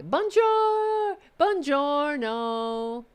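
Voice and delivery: high blast easing into slow drawn-out words; hammed-up, mocking phrasebook show-off; mock fake polyglot. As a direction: imitate someone faking fluency. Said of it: "When you're like".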